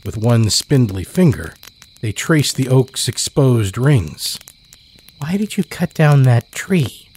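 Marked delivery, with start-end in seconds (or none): 5.2-7.1 put-on high child's voice; child's question. Child asks woodcutter about tree, high voice. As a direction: ask like a puzzled child.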